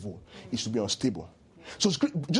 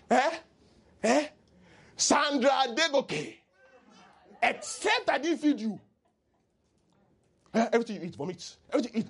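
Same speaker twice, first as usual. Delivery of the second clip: mock parent; 7 semitones higher than usual. Mocking a named person.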